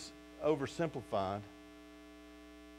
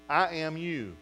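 usual speaking voice

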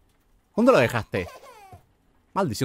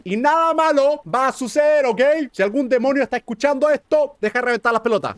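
mock warning to demons; 10 semitones higher, 4 dB louder than usual. Warning any listening demon.

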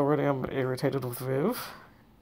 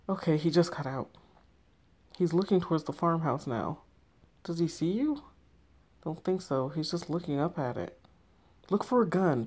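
ordinary delivery

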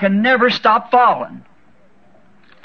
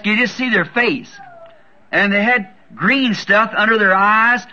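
ordinary delivery

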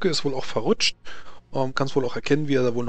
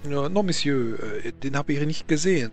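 put-on stammering Frenchman, heavy French accent; nervous French nobleman voice; 2 semitones higher than usual.

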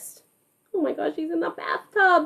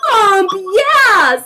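excited shout; 9 semitones higher, 8 dB louder, much slower than usual. Real, not acted. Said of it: "class shouting chorus".

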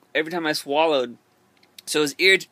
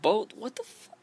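incredulous; 6 semitones higher, 5 dB quieter than usual. Retold or imitated. Retells his reaction to the doctor.

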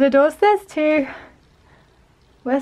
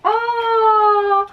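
surprised; 9 semitones higher than usual. Real, not acted. Sees Trolls calendar.

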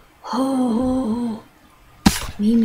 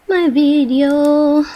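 mock dramatic; 3 semitones higher, 7 dB louder, much slower than usual. Real, not acted.

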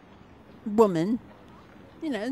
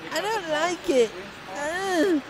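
much higher, distressed repeat; alarmed whine; 9 semitones higher, slower than usual. Real, not acted.

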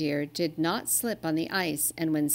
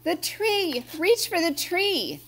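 much higher urgent cries; reindeer crying out; 11 semitones higher, 3 dB louder than usual. A narrator's reading. The littlest reindeer cries out as sleigh slides, high panicked call. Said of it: "cried the littlest reindeer".

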